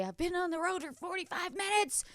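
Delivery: high-pitched mock panic; retold panicking. Stuck driver reporting in to Wendy, retold panic. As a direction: fret in a high, worked-up voice. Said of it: "I was panicking".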